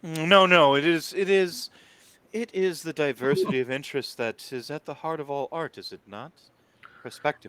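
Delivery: lightly voiced grand art lover holding forth; a touch of theatrical pontificating; theatrical Annabelle, conceding. Game master plays Annabelle.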